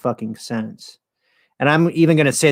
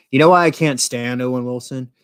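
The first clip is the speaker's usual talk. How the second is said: ordinary talk, nothing marked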